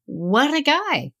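admiring. Retold or imitated. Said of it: "And I say".

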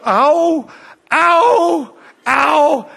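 high, loud mock pain cries of ow. Girl with the broken leg walks without crutches, mock pain cries. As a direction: imitate a girl yelping in pain on each step. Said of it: "And so she go".

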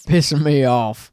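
slow country twang, hammed up; Southern drawl. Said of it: "Is that just chaw?".

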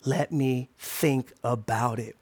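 slow, low and soft; measured restraint. Acted. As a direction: hold back the no, word by word. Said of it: "I'm training myself to say".

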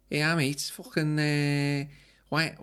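put-on Scouse accent; Liverpool accent. Shown as a demonstration. Host attempting Liverpool on request, Scouse accent. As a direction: do a Scouse pub invitation. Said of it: "What about Liverpool?".

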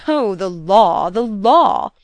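loud, slow, drawn-out scorn; superb jeer. A narrator's reading. Governess mocking the stepmother's legal claim, jeering. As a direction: jeer it grandly, stretching each "law". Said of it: "Missus Wix superbly jeered".

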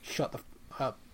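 whispered, voiceless shut up; whispered retort. Retold or imitated. Connie silencing her teasing sister, whispered. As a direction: hiss it under the breath.

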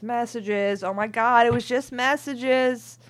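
high, loud, slow protest; indignant shout. Real, not acted. Reader protesting on the cheater's behalf, loud indignant. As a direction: shout it in mock outrage. Defending cheating as just messages.

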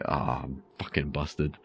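quiet. Retold or imitated. Caught crunching, inner panic.

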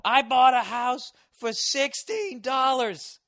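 loud, slow, high pitchman voice; infomercial shout. Pretend. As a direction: yell it like a late-night TV pitchman. Mocking TV infomercial guy.

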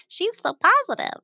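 dismissive squeal of retold praise; mock gushing praise. Mocking the praise she received.

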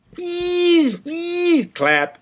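high squeaky pleading. Pretend. Reading listener's email noises.

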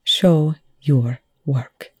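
slow, menacing growl of a repeated demand. A narrator's reading. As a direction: drag out each word, annoyed. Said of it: "Young growled".

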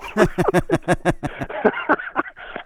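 long wheezy laugh at own punchline; real laughter. Real, not acted. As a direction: crack up over your own story.

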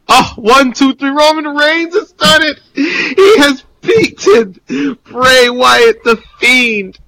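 high-pitched, overwrought victory call; mock commentator frenzy. Host calls imagined title-match finish, high hammed-up frenzy. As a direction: play a commentator losing it at the pinfall, voice way up.

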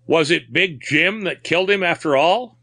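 high, insistent question; mildly exclaimed, pitched well up; crying out. A narrator's reading. Man demanding the killer's name from the captain, crying out mildly. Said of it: "cried Corcoran".

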